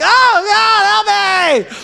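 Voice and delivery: high, loud yelp of pretend agony; mock cry of pain. Preacher retelling his electrotherapy, yelping in mock pain. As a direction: ham up the jolt, squealing for help.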